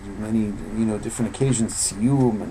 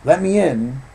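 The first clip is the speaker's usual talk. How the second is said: louder, slow plea to his father; pleading son; 5 semitones higher, 8 dB louder, slower than usual. Retold or imitated. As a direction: beg to be let back in. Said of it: "he's like".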